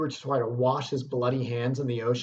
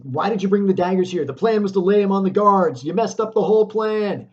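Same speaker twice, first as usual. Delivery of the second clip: loud, higher, fast scolding of her husband; scolding wife; 7 semitones higher, 8 dB louder than usual. Retold or imitated. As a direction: tear into him for botching the plan. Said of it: "Macbeth then condemns her husband".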